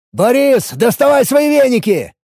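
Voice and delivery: yelled high and loud; shouting. A narrator's reading. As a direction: shout with all your strength. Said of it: "заорал я, что было сил".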